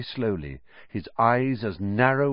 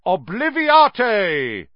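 much higher, louder, slow yelled spell; 11 semitones higher, 8 dB louder, much slower than usual. A narrator's reading. Lockhart casting at Ron, slow high yell.

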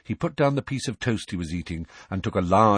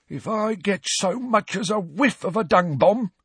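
high, loud warning caretaker; threatening Filch; 12 semitones higher, 4 dB louder than usual. Acted. Filch warning Harry, high menacing voice. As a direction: threaten him from the door.

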